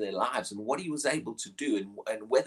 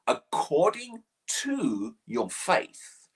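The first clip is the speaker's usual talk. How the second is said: very slow, weighty delivery; slow, reverent words of healing; solemn slow quote; 2 semitones higher, much slower than usual. Acted. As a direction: let each word land slowly. Quoting Jesus' healing words.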